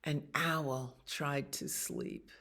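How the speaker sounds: ordinary delivery, nothing marked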